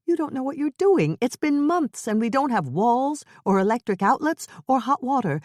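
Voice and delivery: high, loud tirade at her husband; angry outburst. A narrator's reading. Wife berating husband over the freezing house, angry. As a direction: let it all pour out in anger. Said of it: "unable to stop herself".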